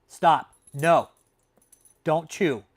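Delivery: sharp command, no nonsense; scolding dog. Real, not acted. Telling Lindy the dog to stop chewing, loud scold.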